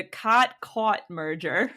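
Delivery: first "caught" as open "kaht", second "caught" as rounded "kawt"; two distinct vowels. Shown as a demonstration. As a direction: keep the two words "caught caught" apart: ah, then aw. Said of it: "I definitely say them different".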